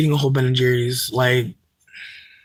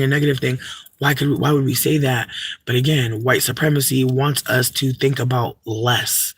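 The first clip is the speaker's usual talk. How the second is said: normal talking voice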